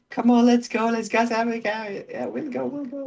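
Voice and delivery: high-pitched eager wife voice; imitating wife. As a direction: plead it high and excited. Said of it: "my wife is like".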